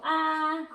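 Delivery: loud open-mouthed ahh; saying ah. Shown as a demonstration. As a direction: show the patient how to say ah. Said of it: "and I go".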